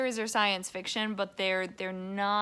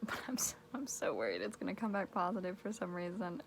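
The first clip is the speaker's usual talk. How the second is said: whispering; 2 semitones lower, 8 dB quieter than usual. Real, not acted. Nervous about test results.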